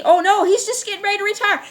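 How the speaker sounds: high, fast sarcastic mimicry; mocking quote. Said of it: "You see".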